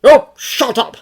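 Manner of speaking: loud gruff bark; gruff man brushing off an apology. A narrator's reading. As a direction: snap it at him. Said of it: "Frank barks".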